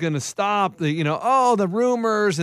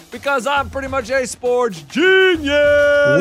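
boastful; 5 semitones higher, 4 dB louder, slower than usual. Acted. Boastful intro.

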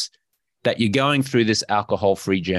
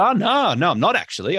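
high, breezy "oh, no"; light, upbeat acted refusal; confident brush-off; 8 semitones higher than usual. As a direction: wave it away cheerfully. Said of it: "you smile and you confidently say".

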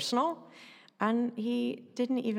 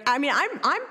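ordinary delivery

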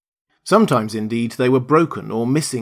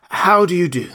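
scarecrow's loud, high greeting, falling at the end; 8 semitones higher, 5 dB louder than usual. A narrator's reading. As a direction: greet heartily, pitch starting high and dropping. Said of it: "answered the scarecrow".

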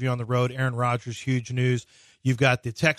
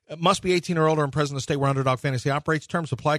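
fast legal disclaimer; faster than usual. Acted. Ad disclaimer read at the end.

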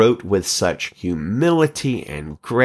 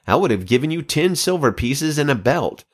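higher, sharp reproach; indignant commander; 9 semitones higher than usual. Acted. Joab rebukes the soldier, higher and indignant. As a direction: demand why he didn't strike. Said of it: "Joab exclaimed".

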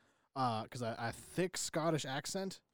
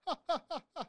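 a high, put-on canned laugh; mock robotic assistant laugh; 13 semitones higher than usual. Imitating the Alexa answering a request to laugh with a mock laugh. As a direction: play the smart speaker cackling on cue. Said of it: "So now it says".

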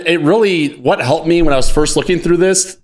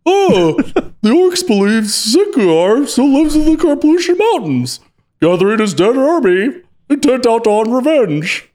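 Ray Romano impression; 8 semitones higher than usual. Host reading the card's flavor text as a higher, hammy sitcom dad. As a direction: read it like a whiny sitcom dad. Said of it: "it's always easier for me just to do, like, Ray Romano".

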